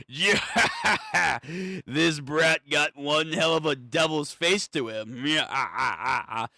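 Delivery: imitating a laugh. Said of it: "he's doing this fucking laugh".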